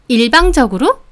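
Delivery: "일방적으로?" thrown back with a sharp rising end; challenging echo. Acted. Customer seizing on the shop owner's word, challenging. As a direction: repeat the word as an offended question. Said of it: "제 말꼬투리를 잡으며".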